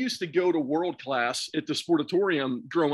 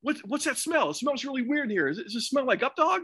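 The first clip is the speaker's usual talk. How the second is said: high-pitched, mock-sincere nagging; sticking with it; 9 semitones higher than usual. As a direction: keep the gag going with a straight face. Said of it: "he just stuck with it".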